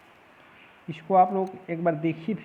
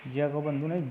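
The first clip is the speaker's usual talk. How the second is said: usual speaking voice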